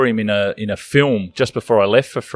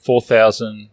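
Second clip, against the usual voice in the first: ordinary talk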